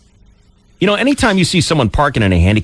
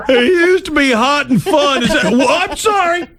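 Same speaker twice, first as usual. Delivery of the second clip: laughing mock sympathy; 12 semitones higher, slower than usual. Real, not acted. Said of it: "That's right".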